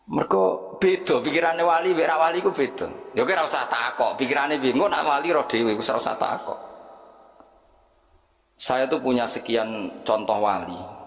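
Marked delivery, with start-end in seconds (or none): none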